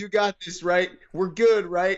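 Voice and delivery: high, upbeat voice of his mom. Retold or imitated. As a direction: play the mom cheerfully offloading it all. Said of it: "I mean, my, my mom".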